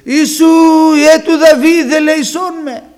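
loud, high, drawn-out cry for mercy; shouted plea to be heard; blind man's shout. Acted. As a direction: call out over a crowd, pitched high. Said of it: "ο τυφλός, φώναζε".

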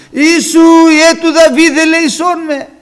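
full-force high, drawn-out cry for mercy; very loud, high-pitched plea over the hushing. Acted. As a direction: defiantly shout it at the top of your voice. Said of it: "Πιο δυνατά αυτός φώναζε".

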